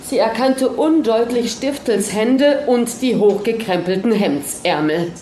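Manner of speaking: ordinary delivery, nothing marked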